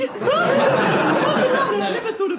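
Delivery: laughing surprise. Real, not acted.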